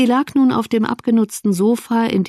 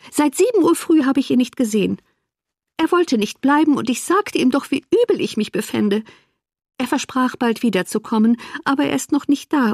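higher-pitched, aggrieved wife; complaining invalid sister; 6 semitones higher than usual. Acted. Wife complains that her husband went off hunting, aggrieved. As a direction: play a neglected invalid, voice lifted in grievance.

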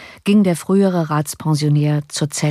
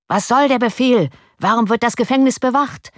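shouted demand; 6 semitones higher than usual. A narrator's reading. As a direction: demand answers like an angry crowd. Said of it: "schrien die Parteigänger des Hauses von Oranien".

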